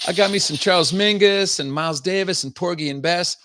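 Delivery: hammed-up "from the block" accent, high and loud; mock Kamala Harris. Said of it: "from the block accent".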